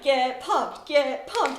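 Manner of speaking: loud, eager pep chant; sequined dad hyping up his daughter; hyped dad. Retold or imitated. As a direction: pump them up like a cheesy dad.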